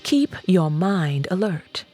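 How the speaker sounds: plain reading voice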